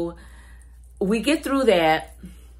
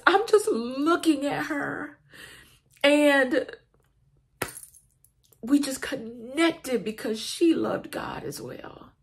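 higher, wavering with emotion; tearful; 7 semitones higher than usual. Real, not acted. Telling viewers about connecting with her, tearful. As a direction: hold back the tears as you testify. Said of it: "And I'm crying just like I am right now".

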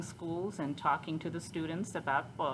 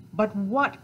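louder, impatient fox retort; mild growl; 4 semitones higher, 8 dB louder than usual. Acted. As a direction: lightly growl the question. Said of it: "growled the fox".

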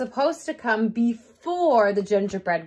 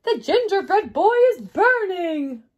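higher, alarmed storybook exclamation; alarmed cry; 9 semitones higher, 6 dB louder than usual. Acted. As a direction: cry out that something is burning. Old woman smells cookie burning.

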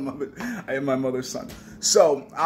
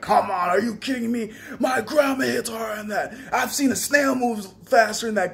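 mock trash talk; 6 semitones higher than usual. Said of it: "I was like".

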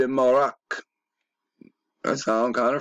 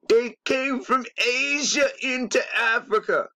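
mock wail; 12 semitones higher than usual. Mock wail about DNA.

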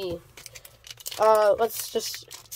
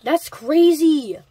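amazed; 5 semitones higher, 4 dB louder, slower than usual. Real, not acted. Amazed at rare pull.

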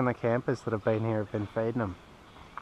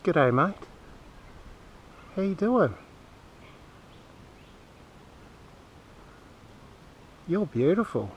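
higher, friendly chat to a bird; pet talk; 4 semitones higher, 3 dB quieter than usual. Real, not acted. Man says g'day and chats to the kookaburra, higher pet-talk voice.

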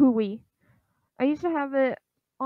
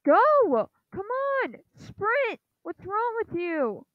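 high, exasperated cry at the game; real high-pitched gamer frustration; frustrated urging; 8 semitones higher, slower than usual. Real, not acted.

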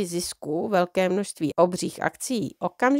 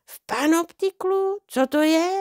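astonished; 9 semitones higher than usual. A narrator's reading. Baffled at the town's name.

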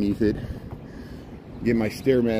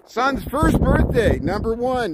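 excited birthday cheer; 10 semitones higher, slower than usual. Real, not acted. Greeting a viewer's son's birthday.

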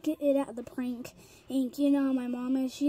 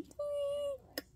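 tiny high squeal, held back; a jump-scare scream done softly; toned-down scream; 13 semitones higher, 8 dB quieter than usual. Shown as a demonstration. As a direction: squeal it high but keep the volume down. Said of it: "they're just gonna scream".

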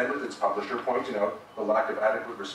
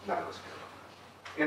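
slow, breathy ironic whisper, pitched up; sarcastic whisper; 8 semitones higher, 7 dB quieter, much slower than usual. Real, not acted. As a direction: let the irony drip, almost voiceless. Sarcastic echo after "Right?.

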